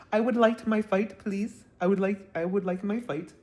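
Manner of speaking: badly faked Jamaican accent, sliding toward Indian. Mimicking a Caribbean woman demanding her fight, bad Jamaican accent. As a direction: attempt a Jamaican lilt and miss. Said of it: "I can't do a Jamaican accent".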